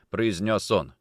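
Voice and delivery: plain reading voice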